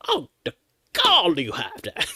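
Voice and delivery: high, theatrical offended gasp; mock outrage. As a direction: play the scandalised diva.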